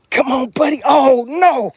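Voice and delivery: high, urgent plea to the fish; plead, then cry out in dismay; high-pitched panic at losing a catch; urgent excitement. Real, not acted. Fighting a fish near the boat.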